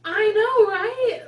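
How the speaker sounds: high, loud "I know, right?"; a loud, high-pitched eager "I know, right?"; emphatic agreement. Retold or imitated. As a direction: burst out agreeing with mom. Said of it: "I'm like".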